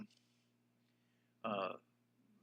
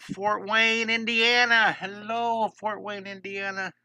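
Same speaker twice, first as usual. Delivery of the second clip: high, stretched out hello to a city; excited greeting; 7 semitones higher, 5 dB louder, slower than usual. Real, not acted. Art teacher welcoming a viewer's hometown, high and drawn out. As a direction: sing out the place name slowly.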